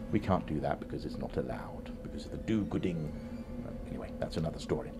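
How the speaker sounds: hushed conspiratorial aside; whispering. Real, not acted. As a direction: whisper it like a secret. Whispered aside about Earth rules.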